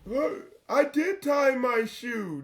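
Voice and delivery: high, slow pleading excuse; whiny recruit excuse. Retold or imitated. Recruit excuse to drill sergeant.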